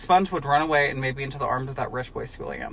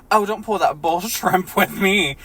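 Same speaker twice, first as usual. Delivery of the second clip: louder slow angry accusation broken by a laugh; angry line cracking up; 5 semitones higher, 9 dB louder than usual. Acted. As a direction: lose it mid-accusation. Squidward angry, laughs inside.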